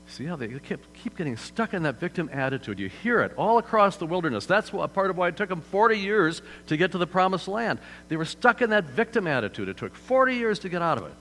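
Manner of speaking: normal talking voice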